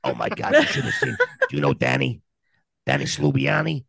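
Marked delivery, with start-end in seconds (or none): none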